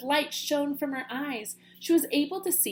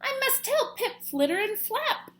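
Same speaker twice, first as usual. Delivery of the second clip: eager; 11 semitones higher than usual. Acted. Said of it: "eager plan".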